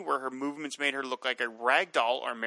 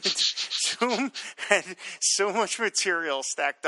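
laughing; 7 semitones higher, 3 dB louder, much slower than usual. Real, not acted. Laughing about Zoom.

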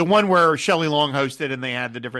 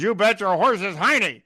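high put-on colonel voice; Colonel Potter impression; 4 semitones higher than usual. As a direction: ham up Potter's catchphrase. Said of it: "He says".

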